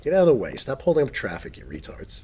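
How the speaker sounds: normal talking voice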